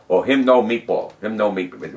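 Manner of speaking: lightly voiced Melanesian pidgin English, the first "no" hit; a hint of Melanesian pidgin English, quoting the natives; imitating Melanesian pidgin English. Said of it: "That's the way they talk".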